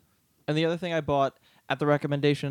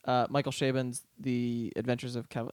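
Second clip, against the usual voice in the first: normal talking voice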